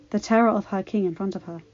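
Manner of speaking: plain reading voice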